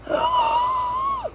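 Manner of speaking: high, theatrical romance-novel gasp; dramatic gasp. A narrator's reading. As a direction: gasp like a swooning heroine. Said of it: "put their hands on their bosoms and gasped".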